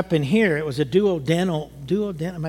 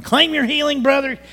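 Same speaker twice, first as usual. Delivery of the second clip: high, louder imitated preacher urging healing; 9 semitones higher, 4 dB louder than usual. Imitating the pastor at the altar, a lightly hammed-up urging. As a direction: mimic the pastor claiming it over you.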